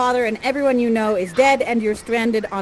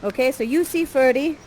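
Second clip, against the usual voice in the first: normal talking voice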